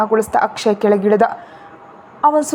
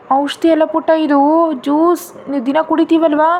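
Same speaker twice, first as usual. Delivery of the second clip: coaxing grandmother; 5 semitones higher than usual. Acted. Grandma coaxing child to drink.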